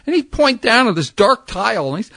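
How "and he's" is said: ordinary talk, nothing marked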